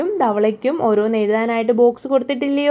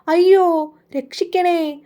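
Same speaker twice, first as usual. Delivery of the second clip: cry for help; 7 semitones higher than usual. A narrator's reading. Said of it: "ആമ നിലവിളിച്ചു".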